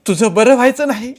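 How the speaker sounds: high, loud angry cry; screamed curse. A narrator's reading. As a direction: hurl it at him in fury. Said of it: "झटकत Renuka ओरडली". (संतापानं ओरडत शाप)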